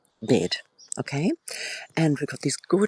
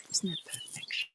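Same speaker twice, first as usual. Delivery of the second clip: a soft hushed whisper; 2 semitones higher, 12 dB quieter than usual. Real, not acted. Whispering to viewers while family sleeps upstairs. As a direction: breathe it so nobody wakes. Quiet admiring in the garden.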